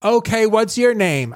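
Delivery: high, eager voice of a confused patient; Mark's eager greeting. Retold or imitated. Patient greeting a stranger in the parking lot, high and eager. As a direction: pitch it up like an overfriendly stranger. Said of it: "He goes".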